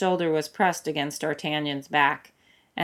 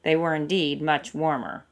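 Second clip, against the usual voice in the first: usual speaking voice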